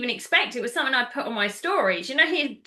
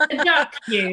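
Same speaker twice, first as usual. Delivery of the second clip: laughing; 3 semitones higher than usual. Real, not acted. Laughing at the duck detail.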